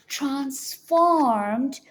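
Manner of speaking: usual speaking voice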